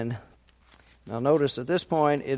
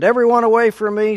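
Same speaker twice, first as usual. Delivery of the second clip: raised, louder and quicker outcry; crying out; 9 semitones higher, 10 dB louder, much faster than usual. Acted. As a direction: call out sharply to clear the room. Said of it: "and he cried out".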